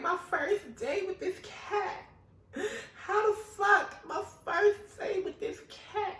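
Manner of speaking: high, slow, laughing whine; hammed-up tearful sister; drawn-out squealing lament; mock wailing sister. Sister wailing over lost cat.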